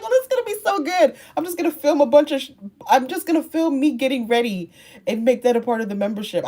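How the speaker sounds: high, fast, gushing self-quote; bubbling over with a new idea; gushing excited. Retold or imitated. Creator retelling her own plan, gushing and excited.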